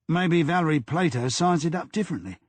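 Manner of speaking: mildly irritated, a touch sharp; understated irritation, pitch lifted; irritably. A narrator's reading. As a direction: brush the idea off with light annoyance. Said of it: "Diamond said irritably".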